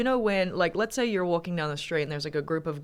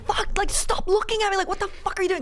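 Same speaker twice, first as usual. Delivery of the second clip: retold yell; faster than usual. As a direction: shout them off, furious and much higher. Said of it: "like, yelling".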